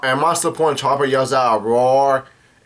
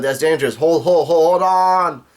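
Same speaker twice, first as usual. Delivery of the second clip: rising, hammed-up alarm; mock panic; 4 semitones higher than usual. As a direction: build to a higher pretend cry for a halt. Diesel spinning out of control, panicking.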